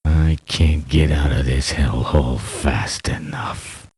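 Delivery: usual speaking voice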